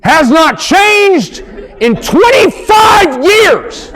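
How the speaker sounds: high, slow, shouting it out word by word; exasperated shout. Acted. Husband at the drive-thru to his wife, exasperated slow high shout. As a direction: lose it at the indecisive wife, slow and loud.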